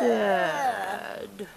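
"Sad" stretched sound by sound; sounded out slowly. Shown as a demonstration. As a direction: draw out each sound of "Sad" softly. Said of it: "say some of these sounds slowly".

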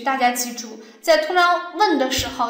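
usual speaking voice

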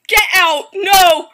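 loud frightened yells; terrified yell. Real, not acted. Gamer yelling at the creature, frightened yells. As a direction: bark it at the monster.

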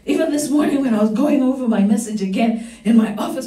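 ordinary delivery

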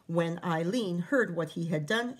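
ordinary delivery, nothing marked

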